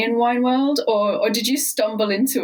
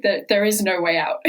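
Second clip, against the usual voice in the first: ordinary delivery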